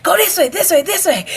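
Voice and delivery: high, urgent mock directions; imitated yelling. Instructors point running recruits onward, imitated yelling. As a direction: imitate instructors herding people along. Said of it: "when they're yelling at you".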